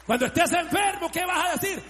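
high, forceful preacher's question; calling out a challenge. Real, not acted. Preacher asking the congregation about times of sickness, calling out a challenge. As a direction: throw the question out to the crowd.

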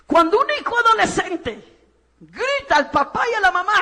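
ordinary talk, nothing marked

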